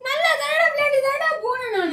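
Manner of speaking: high wailing self-defence; tearful protest. Real, not acted. Blamed friend says they were only playing, tearful and high. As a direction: protest the blame, close to crying.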